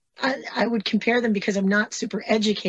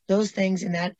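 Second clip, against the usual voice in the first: ordinary talk, nothing marked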